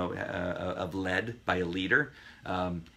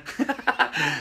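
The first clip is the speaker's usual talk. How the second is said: laugh; 7 semitones higher, 5 dB louder than usual. Real, not acted. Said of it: "thank you, [name]".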